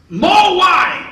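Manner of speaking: very high loud bellow; bellowed order. Acted. Emperor demanding wine, bellowing. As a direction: roar for wine like a tyrant.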